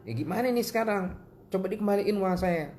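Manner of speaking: high-pitched mock demand; admonishing anger. Shown as a demonstration. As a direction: demonstrate pressing a wrongdoer without shouting. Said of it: "marah itu menasehati".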